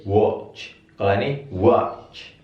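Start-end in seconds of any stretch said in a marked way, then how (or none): 0.0-0.7 British 'watch' with rounded o, wotch; British pronunciation. Shown as a demonstration. Teacher contrasts accents for students, British pronunciation. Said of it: "Kalau British". 1.5-2.3 American 'watch' with open ah, wahtch; American pronunciation. Shown as a demonstration. Teacher contrasts accents for students, American pronunciation. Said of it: "Kalau di American ini dibaca A".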